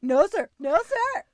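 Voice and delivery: high, loud anxious yes-sir voice; nervous. Retold or imitated. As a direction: blurt it out, scared and polite. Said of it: "like, so nervous".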